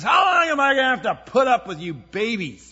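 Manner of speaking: furious yelled rebuke of the people; angry shout. Acted. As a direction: bellow it like an exasperated leader. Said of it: "he got angry".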